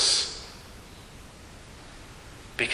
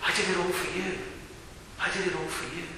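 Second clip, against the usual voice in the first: softer, quietly devoted; soft devotion; 13 dB quieter, much faster than usual. Acted. Christ to his Father, softly devoted. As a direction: offer it up gently to the Father. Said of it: "and he says".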